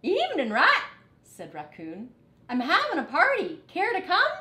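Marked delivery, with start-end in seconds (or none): 0.0-0.8 much higher, loud friendly greeting; high bubbly raccoon hello; cheerful raccoon. Acted. 2.5-4.3 much higher, louder eager invitation; high bubbly raccoon invitation; cheerful raccoon. Acted.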